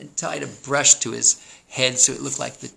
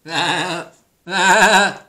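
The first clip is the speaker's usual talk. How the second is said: high, quavering bleats, second one louder; sheep bleat; 8 semitones higher, 11 dB louder than usual. A narrator's reading. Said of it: "bleated Sheep".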